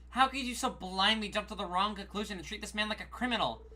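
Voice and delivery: ordinary delivery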